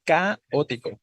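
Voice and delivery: "caótico" mal acentuado, como CÁ-otico; acento raro en CA. Shown as a demonstration. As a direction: pon la tónica en la CA de "caótico". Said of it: "Se oye raro decir". ("caótico" con CA fuerte y alargada)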